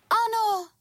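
very high, eager exclamation; little bee crying out. A narrator's reading. Little bee answers the sun, high-pitched cry. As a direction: pipe it out in a tiny bee voice. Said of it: "vykřikla včelička".

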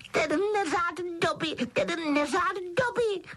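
very high, drawn-out elf shriek; frantic self-scolding squeal; Dobby crying out. A narrator's reading. Elf punishing himself at the window, shrieking. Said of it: "křičel přitom".

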